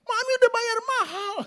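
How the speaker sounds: nagging mother. Retold or imitated. Mother scolding a lazy piano student, loud and high. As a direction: whine it like a fed-up mom.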